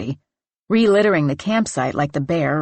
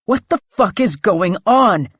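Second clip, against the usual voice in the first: breathless, shouting through ragged breaths; panting; 3 semitones higher, 6 dB louder than usual. A narrator's reading. Clay, panting, demands answers at the wrecked campsite. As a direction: spit it out winded and furious.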